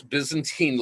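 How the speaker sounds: usual speaking voice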